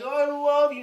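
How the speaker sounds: high, loud mock declaration; high mock gush of love; mock lover's coo. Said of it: "we go".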